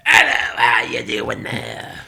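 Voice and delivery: loud low drawn-out goofy voice; goofy phone greeting. Shown as a demonstration. Answering telemarketers, goofy drawn-out greeting. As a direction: answer in a silly, slow, deep voice. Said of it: "When I get telemarketers, I like to go".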